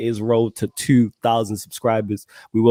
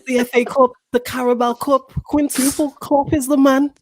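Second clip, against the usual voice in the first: mock Scouser; 10 semitones higher than usual. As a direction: play the gloating Liverpool fan up high. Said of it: "a Scouser trying to say sextuple".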